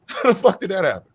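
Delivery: high, loud laughing question; laughing disbelief. Real, not acted. Co-host laughing at a ridiculous conclusion.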